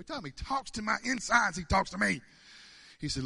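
ordinary talk, nothing marked

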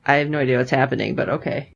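low, quick, resigned aside; bemused bystander. Retold or imitated. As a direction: play the clueless onlooker going along. Quoting bemused Jason beside her.

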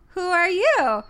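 high, drawn-out cartoon question; mock kids' show greeting; retold Duffy line. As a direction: do a cutesy show-character asking. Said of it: "Duffy goes".

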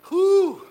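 a loud, high whistling whew; a loud high whew of amazement; whew exclamation. Real, not acted. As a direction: blow out a big whew. Reacting to hard revelation.

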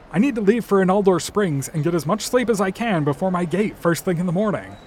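ordinary delivery

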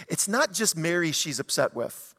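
ordinary delivery

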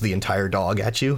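ordinary talk

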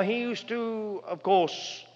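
ordinary talk

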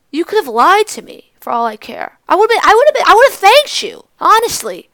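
loud, high indignant outburst; exasperated rant. Acted. Speaker telling him he could have lied, exasperated. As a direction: build to a heated, insistent peak.

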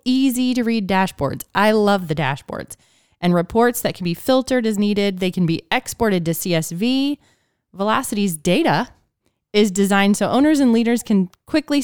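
upbeat scripted sponsor read. Acted. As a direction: sell it like a podcast ad. Reads sponsor copy.

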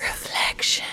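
soft, suggestive whisper; whispered imitation. Host echoing the strip-club sounding name, soft whisper. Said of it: "just the way that Louise says it".

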